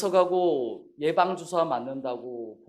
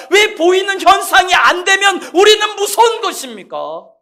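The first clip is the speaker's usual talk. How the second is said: high, loud, building rhetorical questions; full-force preaching; 10 semitones higher, 14 dB louder than usual. Real, not acted. Pastor challenging the congregation, shouting rising questions. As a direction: hammer each "why" harder.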